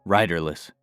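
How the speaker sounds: ordinary delivery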